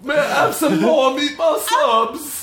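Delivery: high, slow, pleading British urchin voice; Mary Poppins British accent. Acted. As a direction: beg in a hammed-up British accent, drawn out. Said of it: "moved on from Mary Poppins".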